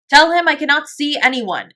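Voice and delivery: loud, high querulous refusal; complaining. A narrator's reading. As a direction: complain from behind a closed door. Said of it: "it said complainingly".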